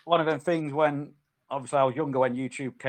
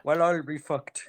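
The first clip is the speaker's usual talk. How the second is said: Irish accent; 3 semitones higher than usual. Shown as a demonstration. Revealing the word joke to friends, Irish accent. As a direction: run it together as an Irish-accent exclamation. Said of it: "in an Irish accent".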